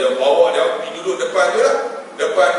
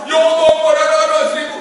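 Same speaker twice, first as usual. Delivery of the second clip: high, fast plea to God; acted tearful outcry; imitated wailing prayer; 10 semitones higher, 5 dB louder, faster than usual. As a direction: cry out the prayer high and urgent. Acts out the man's wailed prayer.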